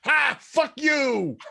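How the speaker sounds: high, loud triumphant jeer; gloating shout. Acted. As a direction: crow defiantly at a beaten foe. Taunts ball that missed.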